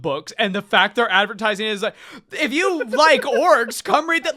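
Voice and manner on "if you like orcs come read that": sneering mock ad voice; mocking sales pitch. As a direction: imitate a clueless promoter, half laughing. Mocking the publisher's advertising.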